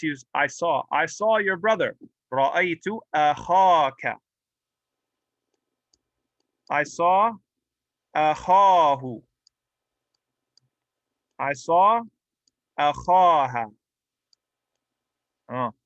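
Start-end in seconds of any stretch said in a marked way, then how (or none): 3.1-4.2 أخاك with the خا held long; long alif vowel. Shown as a demonstration. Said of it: "but with an alif". 8.1-9.2 أخاه loud, with a long-held خا; long alif vowel. Shown as a demonstration. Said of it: "but with an alif". 12.8-13.7 أخاها loud, with خا held long; long alif vowel. Shown as a demonstration. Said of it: "but with an alif".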